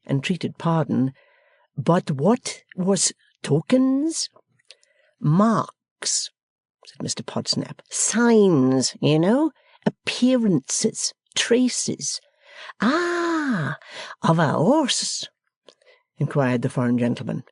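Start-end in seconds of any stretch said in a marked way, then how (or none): 1.8-4.3 put-on slow, higher French-accented question; puzzled foreigner asking. 5.2-12.2 host defining the word; pompous Podsnap. Acted. Podsnap explaining. 12.8-15.3 very high Ah then hushed question; French accent. Acted. Foreigner guessing.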